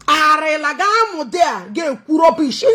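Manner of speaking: a heated shout at the officer; mock shout. Real, not acted. Mocking the officer, a heated shout. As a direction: yell it like an exasperated coach.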